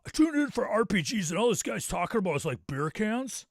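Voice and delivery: high, loud, put-on complaining voice; mock an outraged viewer's comment, loudly; indignant commenter. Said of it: "I could just see a comment now".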